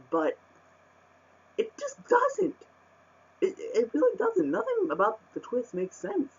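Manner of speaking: high-pitched, incredulous complaint; exasperated rant. Real, not acted. Reviewer ranting that the twist makes no sense, exasperated. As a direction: let real frustration push your pitch way up. Trying not to get pissed, ranting.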